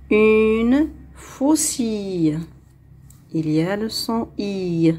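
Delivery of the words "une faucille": slow, drawn-out dictation; slow model reading. Shown as a demonstration. Teacher reading for pupils to hear the sound, slowly. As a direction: stretch each word out clearly. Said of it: "On va lire".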